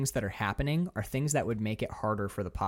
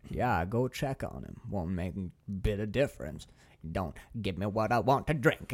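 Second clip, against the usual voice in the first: imitated creepy stranger; 3 semitones lower than usual. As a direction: imitate a creeping stranger with a Maine-ish accent. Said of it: "the stranger goes".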